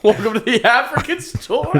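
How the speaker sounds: put-on very high, loud African accent; mock African accent. Storekeeper greets customers, very high, loud mock African accent. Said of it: "How about African?".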